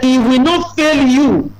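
high, slow, weighty quoting of God's promise; slow lifted proclamation; solemn scripture quote. Retold or imitated. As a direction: raise the voice and stretch it out like a proclamation. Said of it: "He said".

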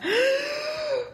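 very high sharp gasp; mighty gasp. Acted. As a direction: gasp in a huge breath. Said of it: "Muskrat took a mighty breath".

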